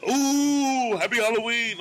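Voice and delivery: high, drawn-out spooky "ooh"; ghost wail. Acted. Host haunting trick-or-treaters through a hidden speaker, ghostly wail. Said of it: "like, a ghost kinda thing".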